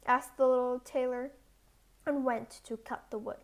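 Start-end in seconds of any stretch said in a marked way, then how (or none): none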